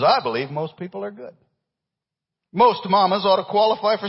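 high, loud country-song twang; slight country accent. Acted. Preacher reading country lyrics to church, country accent. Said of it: "I got a little country, uh, accent there".

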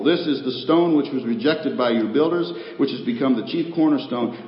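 loud, ringing pulpit proclamation of scripture; full-force preaching. Acted. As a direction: thunder the verses out to the congregation.

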